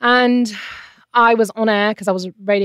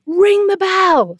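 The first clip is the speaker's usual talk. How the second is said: higher, louder, slow "Ring the bell"; urgent call; 11 semitones higher, 5 dB louder, much slower than usual. Retold or imitated. Midwife telling the husband to ring the bell, a slow loud call. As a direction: call it out like a midwife at the key moment.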